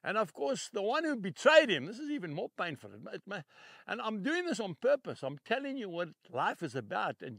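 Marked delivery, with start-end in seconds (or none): none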